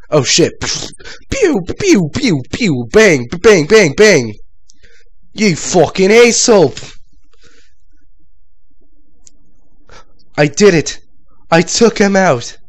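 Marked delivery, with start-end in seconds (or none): 1.3-4.3 loud pew pew bang noises; high, loud toy-gun sound effects; mock gunfire. 5.4-12.6 excited triumph. Real, not acted. Triumph after kill.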